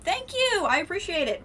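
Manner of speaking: light up at the compliment; delighted thanks. Real, not acted. Streamer thanks viewer Liam for praising her build, delighted.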